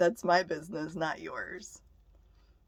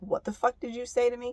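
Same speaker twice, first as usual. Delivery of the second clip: retold confrontation; 6 semitones higher, 3 dB louder than usual. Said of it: "I just turned on him".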